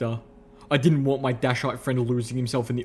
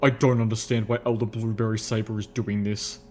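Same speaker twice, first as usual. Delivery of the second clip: ordinary delivery, nothing marked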